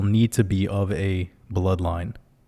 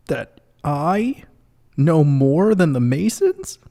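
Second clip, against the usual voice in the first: higher, incredulous whiny viewer voice; hammed-up disbelief, higher; mocking upset viewer; 8 semitones higher than usual. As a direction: parody a shocked follower, pitched up. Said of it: "'cause they're like".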